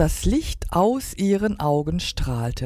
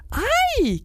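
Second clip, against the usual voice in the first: high, drawn-out mock screech; very high squeal without much force. A narrator's reading. Flitter panics in the dark, screeching. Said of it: "kreischte Flitter".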